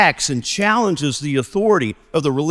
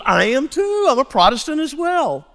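high-pitched acted delight; playing a thrilled fellow believer; delighted agreement; 10 semitones higher than usual. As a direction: chime in eagerly, pitched well up. Stranger delighted at shared denomination.

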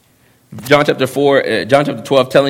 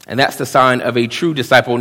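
ordinary talk

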